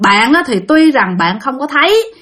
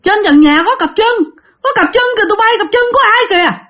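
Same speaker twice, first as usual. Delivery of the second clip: man spotting legs on the ceiling yells to his friends; retold alarmed yell; 10 semitones higher than usual. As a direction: yell out to warn the others of legs in the rafters. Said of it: "ổng la làng lên".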